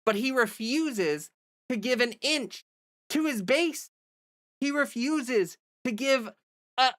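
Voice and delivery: rising anger. Real, not acted. Vlogger ranting about Newsom's refusal, exasperated and high. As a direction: let the frustration boil over. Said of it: "I'm gonna get extremely angry".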